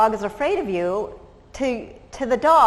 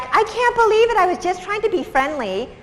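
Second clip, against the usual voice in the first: offended stranger; 10 semitones higher than usual. Retold or imitated. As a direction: play the wounded dog lover, pitch shooting up. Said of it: "they're so offended".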